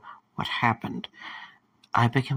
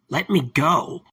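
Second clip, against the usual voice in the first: raised, higher demand to be released; forceful demand; 4 semitones higher, 4 dB louder than usual. Acted. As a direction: fight to break free. Held by the puppet, demand escalates.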